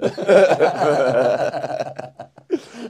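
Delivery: loud, high burst of laughter; real laughter. Real, not acted. Laughs at own punchline. (luuts, höchs Lache)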